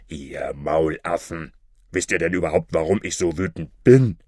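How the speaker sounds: gruff captain growl; angry robber chief. A narrator's reading. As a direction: berate the dim robbers. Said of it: "fragte er".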